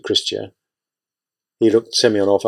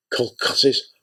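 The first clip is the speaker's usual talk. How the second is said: mournful; 4 dB quieter than usual. A narrator's reading. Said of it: "Aunt Christia repeated mournfully".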